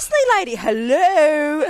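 loud, high, exasperated call for attention; mock indignant. Ignored dog owner to passing lady, mock indignant. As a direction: play up the affronted owner.

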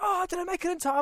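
high eager voice of the man arriving late; eager guy. Retold or imitated. Late driver asking about prize, high and eager. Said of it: "he's like".

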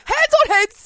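high, loud classroom order; teacher command. Retold or imitated.

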